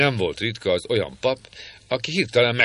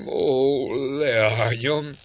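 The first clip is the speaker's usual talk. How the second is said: flustered priest; 5 semitones higher, much slower than usual. Acted. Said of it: "felelte Cedric nagy zavarban".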